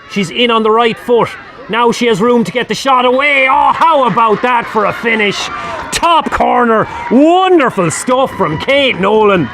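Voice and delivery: high, thrilled commentary shout; excited goal call. Real, not acted. Commentator hailing a top-corner finish, excited. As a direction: let the voice soar as the shot goes in. Striker shoots and scores in live commentary.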